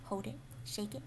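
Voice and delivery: whispered instruction; hushed demo whisper. Shown as a demonstration. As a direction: show them how to whisper. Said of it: "you can always whisper it".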